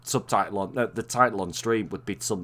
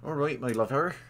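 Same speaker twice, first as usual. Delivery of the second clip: a light Bristolian "All right, my lover" with a rising lilt; lightly put-on Bristolian greeting; Bristolian accent; 3 semitones higher than usual. Teasing Lucy with a light Bristolian greeting. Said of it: "you sound like my fucking sister-in-law".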